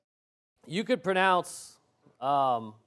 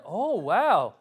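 a touch of put-on wonder, swooping pitch; mock amazement; 3 dB louder than usual. Preacher imitating people who want some new thing, mock wow. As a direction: lightly mimic an easily impressed listener.

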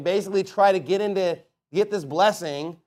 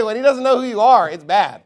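ordinary delivery

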